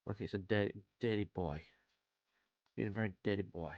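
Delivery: mock scolding voice, dirty boy; scolding a naughty pet. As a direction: tease it like a naughty dog. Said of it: "I don't know why I'm talking like that about a cape".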